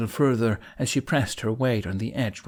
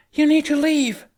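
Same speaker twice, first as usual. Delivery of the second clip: much higher, much louder command; urgent shout; 7 dB louder than usual. A narrator's reading. Astrid ordering the Vikings out, urgent shout. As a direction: yell over the chaos.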